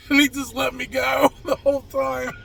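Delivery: high voice breaking into laughter; real laughing. Real, not acted. Mechanic realising the customer let him go on, real laughing. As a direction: laugh helplessly through the words. Laughing at his own story.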